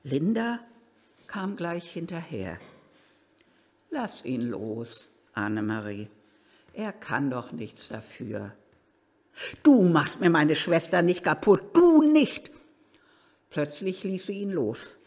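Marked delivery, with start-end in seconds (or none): 3.9-8.5 gentle plea. Acted. Linda calms the furious sister. 9.6-12.5 furious shout. Acted. Annemarie defending her sister, building to a loud shout.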